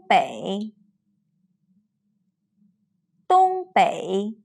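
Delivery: first tone then a full dipping third tone, each word said twice; clear tone drill. Shown as a demonstration. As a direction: say 机场 开始 东北 公里 辛苦 with a level first syllable and a full falling-rising second. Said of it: "Please read after me".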